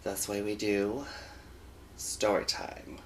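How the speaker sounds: hushed breathy intro; whispering. Acted. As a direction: whisper it low and slow.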